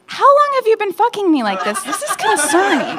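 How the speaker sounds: hammed-up incredulous shock; mock alarm. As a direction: act shocked at the ex's news. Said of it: "I was like".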